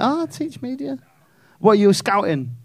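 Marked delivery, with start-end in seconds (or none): 0.0-1.0 higher, softer mousy voice; timid teacher. Retold or imitated. Comic mimicking teacher's meek answer, high and soft. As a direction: shrink into a meek little squeak. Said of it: "but you were like".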